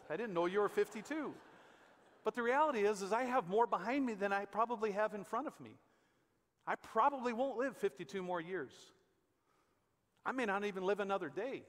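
ordinary talk

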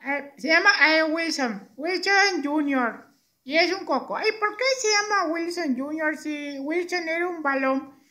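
high, cheeky puppet voice; Beto, muñeco respondón. Acted. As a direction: object in a squeaky little puppet voice. Voz del muñeco Beto. (voz aguda de muñeco preguntón)

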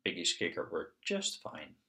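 ordinary delivery, nothing marked

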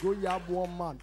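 ordinary talk

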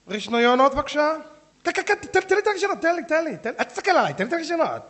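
high, loud, insistent grabbing voice; loud, high mock demand to be first in line; pushy demanding woman. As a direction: play a woman pushing to be served first.

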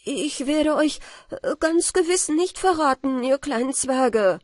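put-on frightened boy promising the dwarfs; anxious plea. A narrator's reading. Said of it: "rief ich".

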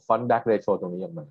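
ordinary talk

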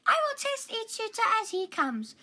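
a hint of a high royal voice; lightly voiced princess. Princess deciding to test suitors, lightly voiced. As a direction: princess making a plan.

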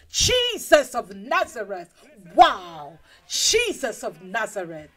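loud, drawn-out amazement; awestruck exclamation. Acted. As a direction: cry out in wonder at a miracle. Crowd marvels at Lazarus raised.

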